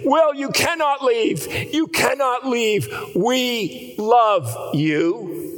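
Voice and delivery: high, slow desperate plea; pleading shout. Retold or imitated. Older brother pinning him down, a pleading shout. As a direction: hold him back and beg him to stay. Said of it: "tackled him to the ground and held him there and said".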